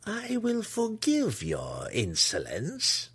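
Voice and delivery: high, slow, gracious condescension; regal, drawn-out magnanimity. A narrator's reading. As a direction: grant pardon grandly, stretching the words. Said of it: "she offered graciously".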